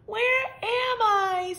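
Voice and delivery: high, slow, small question; ask, dazed and lost; lost baby bird. A narrator's reading. Baby bird set down somewhere, high and bewildered.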